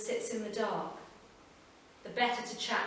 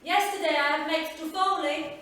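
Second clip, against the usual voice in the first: higher, louder voice of an Italian grandmother calling; Italian nonna; 8 semitones higher, 8 dB louder than usual. Acted. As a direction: call the girl sweetly as her nonna. Grandmother offers a bribe.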